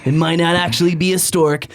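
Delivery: high hyped-up infomercial pitch; fake announcer voice. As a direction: sell it like a cheesy TV ad. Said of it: "That's my fake announcer voice".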